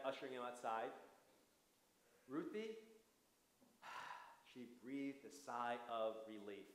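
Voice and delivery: put-on gentle storybook reading for kids; story narration. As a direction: read it like a bedtime story. Reads story to children.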